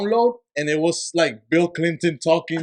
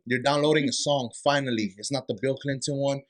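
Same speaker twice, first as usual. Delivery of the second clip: ordinary delivery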